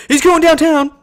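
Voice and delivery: very loud, high arcade announcer yell; game announcer shout. Retold or imitated. Friend quoting NBA Jam, hollering in announcer voice. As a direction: belt it out like a sports game announcer.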